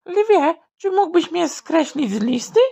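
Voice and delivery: tiny high rabbit voice; high hare voice. Acted. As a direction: ask shyly in a high little voice. Hare asks lion.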